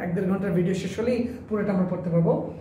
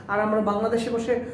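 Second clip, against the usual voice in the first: ordinary delivery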